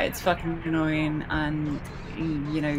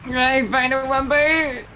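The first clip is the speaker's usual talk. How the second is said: silly high voice; 9 semitones higher, 6 dB louder, slower than usual. Pretend. Silly voice callout.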